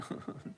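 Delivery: brief, very quiet laugh; a small laugh under the breath; soft chuckle. Real, not acted. Trader amused that somebody dislikes his accent, soft chuckle.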